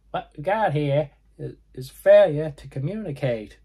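high nasal quote, said properly this time. Retold or imitated. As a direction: go high and nasal on the famous line. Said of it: "very high, nasally voice".

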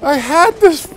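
high, loud, slowed wail of disbelief; can't accept what just happened. Real, not acted. Player groaning at his own cliff fall, high loud disbelief. Disbelief after a dumb death.

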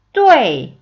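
뚜에이 as one syllable sliding sharply down; falling fourth tone. Shown as a demonstration. Teacher demonstrating fourth tone, 뚜에이 in one falling sweep. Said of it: "이렇게 되고요".

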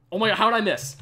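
real exasperation bursting out; frustrated outburst. Real, not acted. Streamer missing a shot at the ghast, frustrated outburst.

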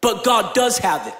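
usual speaking voice

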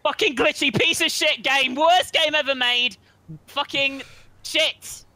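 high, slow furious rant; raging gamer. Real, not acted. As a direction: rage at the broken game. Rages at glitchy game.

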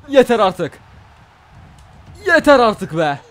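loud fed-up shout at the game. Real, not acted. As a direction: yell that enough is enough. Fed up with opponent.

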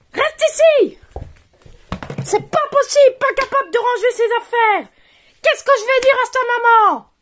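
high, loud exasperated telling-off; mother scolding her son for the toy on the stairs; furious scolding. Acted. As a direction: tear into the careless boy. Mother scolds son over fall.

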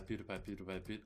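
usual speaking voice

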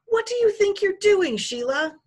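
high, loud indignant yell. A narrator's reading. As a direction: yell it like an outraged kid confronting someone. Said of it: "he yelled".

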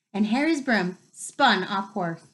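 ordinary delivery, nothing marked

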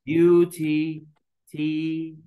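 "بيوتي" slowly, held high, then "تي" alone; syllable by syllable. Shown as a demonstration. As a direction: sound out "بيوتي" in level, separated beats. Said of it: "أدي مقطع".

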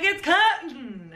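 high, drawn-out mock dismissal; mock carefree quote. Retelling how she ruined clothes ironing, mock carefree. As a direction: toss it off high and slow, imitating your careless self. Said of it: "I was just like".

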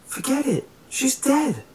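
very high-pitched, insistent retort; urgent dismissal. A narrator's reading. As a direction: shut the idea down urgently, pitch way up. Acted urgent argument during escape.

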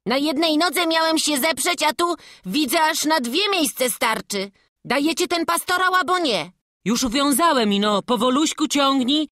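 put-on lively boy calling from a height; cocky boy. Boy calls down for the crozier, cocky. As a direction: give orders as a sure-footed lad.

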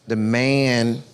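ordinary talk, nothing marked

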